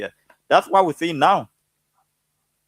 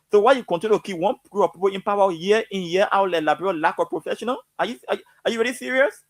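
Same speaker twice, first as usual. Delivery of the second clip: rising to a high, exasperated challenge; emotional outburst; 6 semitones higher than usual. Real, not acted. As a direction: pour out real frustration at the listeners. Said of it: "I'm really emotional".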